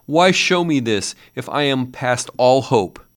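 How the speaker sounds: frightened Scrooge begging the ghost; desperate pleading. A narrator's reading. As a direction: plead for his life. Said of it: "he cried".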